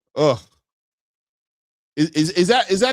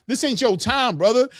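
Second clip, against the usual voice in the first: higher mock scolding of a fighter; mock referee scolding; 9 semitones higher than usual. Host voices the referee scolding the celebrating winner, mock stern. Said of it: "the referee's trying to".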